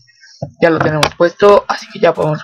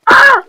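very high, loud dying shriek; piggy death squeal; 6 dB louder than usual. Pretend. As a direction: squeal as the pig dies. Pig dies. (chillido agudo y fuerte del cerdito al morir)

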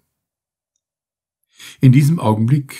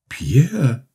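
calling out; 7 semitones higher than usual. A narrator's reading. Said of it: "rief er".

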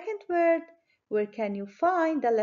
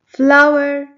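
Flower boomed out for the class to hear; loud model word; 3 semitones higher, 13 dB louder than usual. Shown as a demonstration. Teacher to kids, calling the word out loud. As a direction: call out Flower loudly and clearly. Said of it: "Let's clap our hands when you hear".

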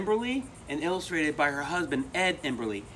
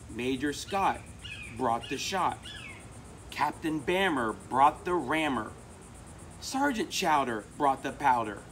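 rhythmic picture-book read-aloud; reading to children. A narrator's reading. Reader sharing a favorite book with children, rhythmic storytelling. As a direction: read the cumulative rhyme to kids, steady beat.

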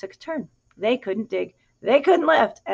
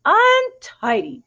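much higher, louder and very slow; fussy second hen; 11 semitones higher, 7 dB louder, much slower than usual. A narrator's reading. Second hen judges the pigs' mess, high and drawn out. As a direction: stretch it out in prim disgust. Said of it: "said the second".

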